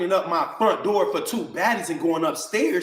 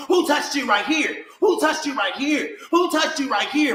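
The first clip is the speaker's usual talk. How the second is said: imitating a screaming mother; 7 semitones higher than usual. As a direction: mimic her demanding, repeated shouting. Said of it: "screaming at her".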